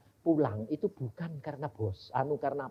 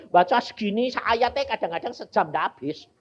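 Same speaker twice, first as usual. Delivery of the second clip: ordinary delivery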